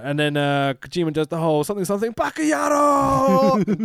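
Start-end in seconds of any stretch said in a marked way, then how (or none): 2.1-3.8 high, long-drawn mock battle cry; imitated wrestler's yell. Host imitating a wrestler's corner catchphrase, drawn-out yell. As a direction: bellow it high and stretch the end.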